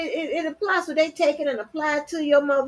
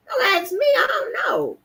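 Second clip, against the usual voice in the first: exasperated shout; 11 semitones higher than usual. Real, not acted. Fed up with people's questions.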